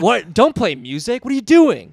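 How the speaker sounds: high, loud affronted protest; retold indignant outburst. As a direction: imitate an exasperated comic objecting. Retells Joe snapping at music.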